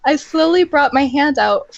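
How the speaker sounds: ordinary delivery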